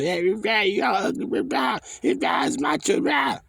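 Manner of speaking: high, shrieking parody of a protester's yell; mock yelling woman. Preacher imitates women yelling at people, high mock shriek. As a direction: screech it like a caricature of an angry protester. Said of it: "got the audacity to yell at people".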